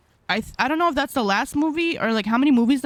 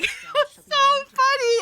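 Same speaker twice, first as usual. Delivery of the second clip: high, laughing almost to tears; can barely speak for laughing; real laughter cracking the voice; laughing hard; 13 semitones higher than usual. Real, not acted. Laughs about the funny scene.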